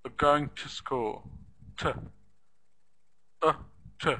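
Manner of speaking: Are as "uh", to as a short "tuh"; reduced "are" and "to". Shown as a demonstration. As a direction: clip are and to down to tiny schwa syllables.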